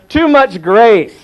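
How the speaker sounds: loud, slow, mocking the letter writers; mock complaint. Reading out a critic's letter, loud mock complaint.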